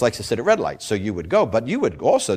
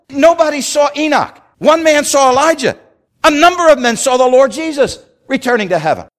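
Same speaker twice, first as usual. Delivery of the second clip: high, loud proclamation; ringing climax; 13 semitones higher, 7 dB louder than usual. Acted. Preacher to congregation driving home who saw what, loud and high. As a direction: hammer the three facts home.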